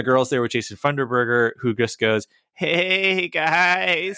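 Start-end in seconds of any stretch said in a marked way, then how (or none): 2.5-4.2 high, drawn-out nerdy greeting; hammed-up goofy dork hello; dorky character voice. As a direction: stretch it out like a cartoon loser. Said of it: "If you talk like him".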